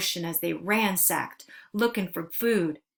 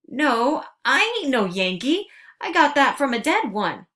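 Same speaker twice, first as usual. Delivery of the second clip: sputtering; 6 semitones higher, 7 dB louder than usual. Acted. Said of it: "I sputtered".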